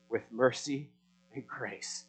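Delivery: usual speaking voice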